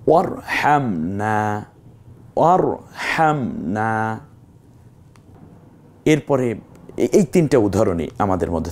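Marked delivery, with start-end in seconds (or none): 0.1-4.2 slow recited ওয়ারহামনা, the final a lightly lengthened; ওয়ারহামনা recited slowly; Quran recitation. Shown as a demonstration. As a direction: chant ওয়ারহামনা slowly with a gentle stretch at the end. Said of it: "এক আলিফ হালকা একটু টানতে হবে".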